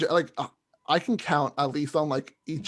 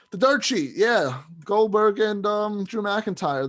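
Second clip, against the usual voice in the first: higher, slow hype read of "the Dirt Sheet"; announcer voice; 6 semitones higher, 4 dB louder, slower than usual. Pretend. Podcaster re-announcing the segment in a mock hype voice. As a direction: draw out "the Dirt Sheet" like a big reveal.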